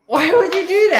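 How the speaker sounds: high, loud, protesting why would you; a mock-outraged yelp at her partner for telling; shocked outcry. Real, not acted. Partner reveals her birth year.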